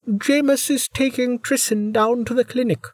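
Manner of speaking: put-on a young girl calling out. A narrator's reading. Ellis, young voice.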